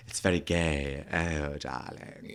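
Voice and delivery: low, drawn-out camp posh accent. Shown as a demonstration. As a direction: put on a very camp posh darling. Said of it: "generic posh. It's just like".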